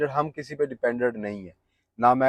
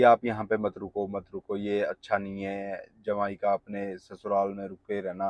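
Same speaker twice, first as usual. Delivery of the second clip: quoting critics; 5 semitones lower, 6 dB quieter than usual. Retold or imitated. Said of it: "जैसे पहले मेरे को बोला जा रहा था".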